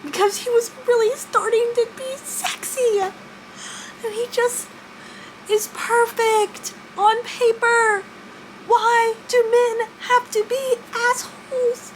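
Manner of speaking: high, drawn-out whine; high whiny lament. Real, not acted. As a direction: whine it out in mock despair. Disappointed about Ryle.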